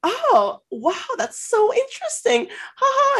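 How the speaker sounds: high, hammed-up awe with fake laugh; over-the-top fake fascination; mock gushing. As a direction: imitate a girl fawning over a bragger. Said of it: "her being like".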